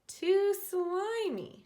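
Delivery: high, loud, slow yuck; disgusted. A narrator's reading. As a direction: drag out the disgust. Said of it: "she said".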